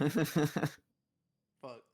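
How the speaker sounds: loud curse. Real, not acted. Reacting to bad luck.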